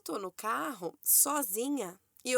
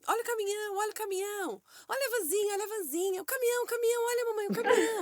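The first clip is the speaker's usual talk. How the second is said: put-on high, excited little-kid voice, much higher; imitating her toddler son; 10 semitones higher, faster than usual. Mother imitating her son spotting trucks, high excited kid voice. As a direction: squeal it like a truck-crazy toddler. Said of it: "o tempo inteiro falando assim".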